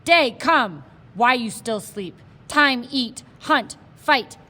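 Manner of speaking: loud calling shout across a canyon. Acted. Indian wakes the sleeping boy, loud shout. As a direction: bellow it, hands cupped, to wake him. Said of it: "the Indian shouted".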